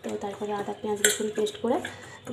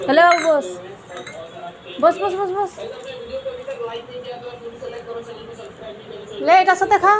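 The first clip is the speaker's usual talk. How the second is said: calling out; 6 semitones higher, 4 dB louder than usual. Real, not acted. Calling the pet to eat.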